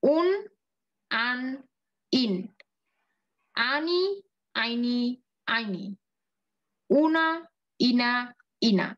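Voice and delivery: slow drilled recitation of endings; চাবি recite. Shown as a demonstration. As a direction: recite each ending slowly with a pause. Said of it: "আবার বলতেছি প্রথম থেকে". (ধীরে ধীরে আবৃত্তি করে পড়া)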